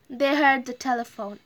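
usual speaking voice

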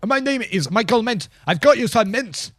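chirpy delivery man announcing himself; Michael Mintz. Acted. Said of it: "where Michael Mintz comes from".

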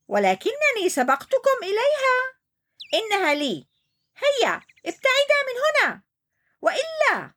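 high, mock-threatening hippo; threatening shout. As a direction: chase them off with a threat. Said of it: "صرخ عنتر". (عنتر يهدد فلفول وبنور)

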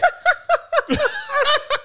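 high, hearty burst of laughter; real laugh after the taunt; big laugh. Real, not acted.

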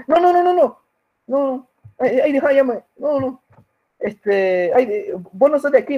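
high, flustered backing-off voice; imitating the robber. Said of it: "Y el tipo así como".

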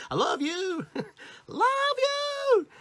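very high, squealing love-you with laughs; hammed-up gushing. Shown as a demonstration. Speaker joking about cheesy love, squealing high. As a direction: send it sky-high and silly.

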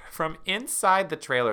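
normal talking voice

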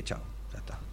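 very soft whispered aside; hushed resignation. Retold or imitated. Fan recalling his reaction to two penalty saves, hushed resignation. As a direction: drop to a bare whisper.

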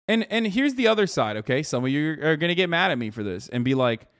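ordinary delivery

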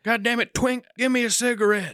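high, hammed-up angry demand; demanding homeless guy. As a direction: imitate an irate street panhandler. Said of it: "and I'm like".

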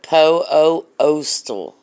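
slow stretched po-o-ostal for effect; drawn out word. Real, not acted. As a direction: drag the vowel of po-o-ostal out long.

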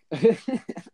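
short high laugh at himself. Real, not acted. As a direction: burst out laughing after blanking. Laughs after losing his words.

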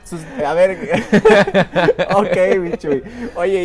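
talking through real laughter; laughing. Real, not acted. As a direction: laugh while asking, loud. Host laughing at guest's joke.

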